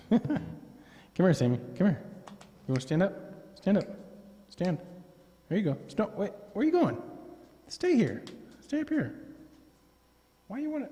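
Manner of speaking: high, soft pet-talk commands; coaxing the dog. Real, not acted. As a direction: coax her gently up.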